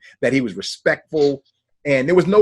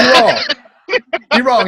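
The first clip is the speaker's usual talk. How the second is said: higher, louder, through a laugh; loud telling-off; 10 semitones higher, 7 dB louder than usual. Retold or imitated. Calling out the cousin on the phone, loud and laughing. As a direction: call him wrong, loud, half laughing.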